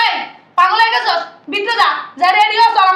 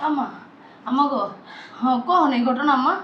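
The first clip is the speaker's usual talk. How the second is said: lower, softer, dazed question; stunned hush; 8 semitones lower, 7 dB quieter than usual. Acted. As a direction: ask in a shocked hush. Stunned daughter asks mother. (হতবাক নিচু গলায়)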